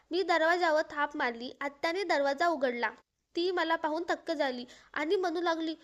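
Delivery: plain reading voice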